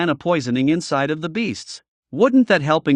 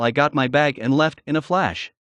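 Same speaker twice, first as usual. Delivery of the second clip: ordinary delivery, nothing marked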